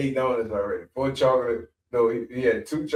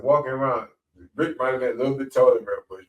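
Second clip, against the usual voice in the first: ordinary talk, nothing marked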